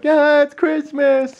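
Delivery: very high, slow, loud squeal as the dog; dog's happy Christmas squeal, high and slow; voicing the dog. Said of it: "he was like".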